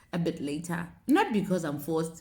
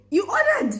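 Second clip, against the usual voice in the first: high, loud incredulous question; can't believe he did it; disbelief; 3 dB louder than usual. Retold or imitated. Wife disbelieves husband ordered inverter.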